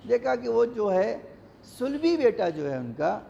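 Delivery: ordinary talk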